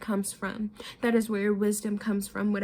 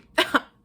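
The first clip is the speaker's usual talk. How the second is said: burst of laughter; 7 semitones higher, 7 dB louder than usual. Real, not acted. Laughs at own example.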